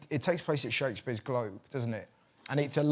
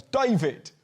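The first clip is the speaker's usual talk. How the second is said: put-on child's cheeky call, louder and higher; kid calling out; 6 semitones higher, 5 dB louder than usual. Ten-year-old brother hailing David Gray, cheeky loud call. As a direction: shout a name across at a hero. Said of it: "marches up to him and goes".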